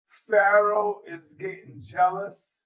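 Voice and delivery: PHARAOH drawn out, slow and deliberate; slow emphatic repeat. Real, not acted. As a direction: stretch out "Pharaoh is getting jealous" for effect.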